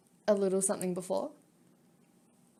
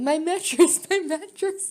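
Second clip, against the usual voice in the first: mock wail, higher and teasing; mocking shout; 6 semitones higher, 5 dB louder, slower than usual. Teasing Chrome by mimicking his whiny shout. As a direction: mimic a whiny cry for the mattress. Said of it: "Usually you'd be shouting".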